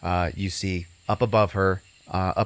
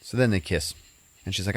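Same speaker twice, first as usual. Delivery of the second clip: normal talking voice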